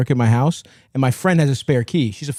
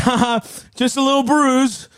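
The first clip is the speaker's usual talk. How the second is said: high, brushing-it-off fake laugh; forced laugh; 10 semitones higher than usual. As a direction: laugh it off like nothing happened.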